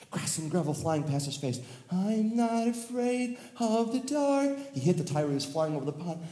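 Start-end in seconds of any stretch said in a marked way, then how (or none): 1.9-4.6 higher, slow brave chant; brave cry. Acted. Tommy racing down the slide, brave high chant. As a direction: call it out bravely, slowly.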